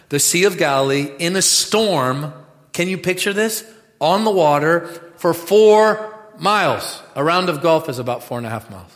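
loud, high, slowed preaching; fiery sermon climax; preaching at full force. Acted. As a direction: build it for the whole room. Preaching Jesus on the water.

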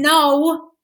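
loud, high no; the daughter begging to keep the great aunt out; loud refusal. Real, not acted. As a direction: shout it down before she comes in. Refusing again.

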